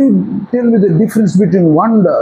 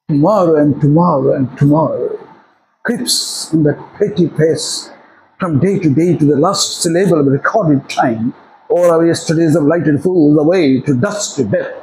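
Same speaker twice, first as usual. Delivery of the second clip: slow, lower-pitched Shakespearean recital; soliloquy recitation; 4 semitones lower, much slower than usual. Shown as a demonstration. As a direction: deliver a measured soliloquy, lingering on each phrase. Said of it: "ইংরেজি soliloquy তোমার মনে হচ্ছে বলবে". (ধীর, নিচু সুরে নাটকের স্বগতোক্তি আবৃত্তি)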